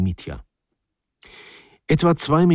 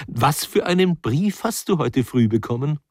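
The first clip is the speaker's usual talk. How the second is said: higher-pitched, bursting question; blurting out; 6 semitones higher than usual. A narrator's reading. Wife confronts husband about the letter, blurting out. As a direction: blurt it out suddenly. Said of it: "platzte heraus".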